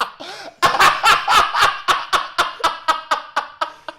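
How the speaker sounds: long, high wheezing laugh; helpless laughter. Real, not acted. Storyteller losing it at fed-up friend, long helpless laugh. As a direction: lose it completely at the memory.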